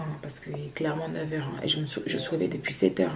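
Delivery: voix du réveil, friture vocale; voix du matin. Real, not acted. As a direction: parler avec la voix du matin. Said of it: "j'ai ma voix du matin". (voix ensommeillée du matin)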